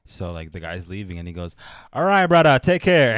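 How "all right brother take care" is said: loud, high mock Pacific Islander accent; imitated islander accent. Trainer saying bye to huge islander, loud imitated islander accent. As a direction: holler a friendly farewell in put-on islander accent. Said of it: "like, Pacific Islander".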